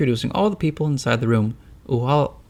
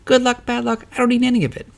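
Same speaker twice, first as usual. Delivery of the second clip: very high, loud snapping crone; shrill tavern keeper; 12 semitones higher, 4 dB louder than usual. Acted. Tavern keeper shouting down Beatrice while swinging the broom, high and loud. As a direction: swat the luck talk away in a high shout.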